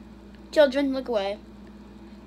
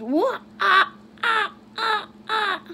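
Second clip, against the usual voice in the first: imitating the video; 9 semitones higher, 4 dB louder, slower than usual. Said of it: "it goes".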